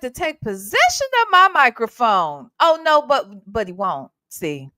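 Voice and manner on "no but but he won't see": usual speaking voice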